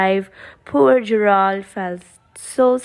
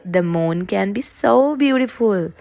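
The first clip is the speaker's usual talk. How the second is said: usual speaking voice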